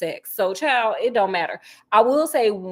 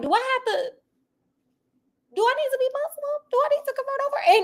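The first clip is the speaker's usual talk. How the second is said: quoting Vea; 14 semitones higher, faster than usual. Retold or imitated. Vea asking Sonny about converting, very high. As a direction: plead way up high and quick. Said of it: "so she's like".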